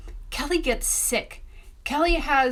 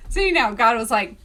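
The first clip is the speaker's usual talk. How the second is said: ordinary delivery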